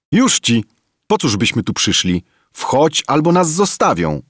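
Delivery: put-on high, loud urgent boy's shout; Kim shouting. A narrator's reading. Kim shouts at the lama to board, high urgent yell. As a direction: hurry the old man aboard, calling out. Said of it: "krzyczał Kim".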